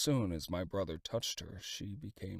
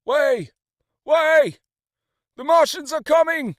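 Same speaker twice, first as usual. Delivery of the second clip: desperate high shout; crowd shouting; 20 dB louder than usual. A narrator's reading. Refugees fleeing warn the road, frantic shout. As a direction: yell it as panic drives the crowd. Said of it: "Through it all ran a refrain".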